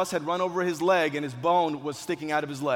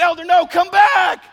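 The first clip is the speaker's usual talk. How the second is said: hammed-up high, drawn-out yell; panicked shout; 13 semitones higher, 13 dB louder, slower than usual. Storyteller re-enacting a plea to an injured boy, panicked shout. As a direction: call him back in comic panic.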